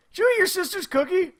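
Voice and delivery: high, stunned accusing question; dad's accusing question. Retold or imitated. Dad catches daughter with half-eaten cookie, high and stunned. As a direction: catch her out in disbelief. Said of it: "I look at her and said".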